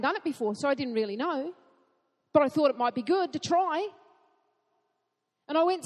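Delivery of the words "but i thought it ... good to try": ordinary talk, nothing marked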